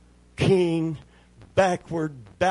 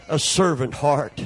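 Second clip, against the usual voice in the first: ordinary talk, nothing marked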